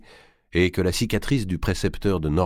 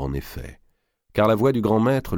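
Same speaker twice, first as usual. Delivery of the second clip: ordinary delivery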